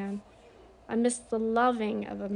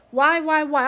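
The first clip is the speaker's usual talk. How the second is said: a loud, higher cry of regret; anguished cry; 4 semitones higher, 9 dB louder than usual. Retold or imitated. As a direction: cry out why in guilt. Imagined regretful cry of guilt.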